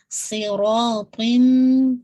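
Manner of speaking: صراطم very slow, ص ر ط heavy, ending in nasal م; heavy letters. Shown as a demonstration. Teacher modelling a heavy صراطم. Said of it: "تو کو موٹا کرتے ہوئے".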